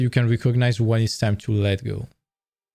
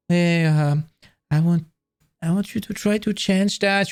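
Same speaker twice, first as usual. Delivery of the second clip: tentative, higher-pitched appeal; pleading gently in a raised voice; acted bargaining; 6 semitones higher than usual. Person bargaining for someone to change, tentative acted appeal.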